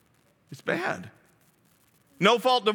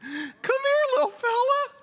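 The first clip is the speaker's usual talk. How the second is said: very high, cooing fake friendliness; fake buddy politician; slower than usual. Politician sweet-talking a voter, falsely cooing. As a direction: sweet-talk like a schmoozing politician. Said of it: "they pretend to be your buddy".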